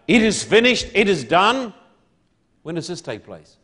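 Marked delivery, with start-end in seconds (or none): 0.1-1.7 raised, louder preacher's cry; ringing quoted outcry; imitated crying out. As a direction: proclaim it as a cry from God. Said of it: "cries out".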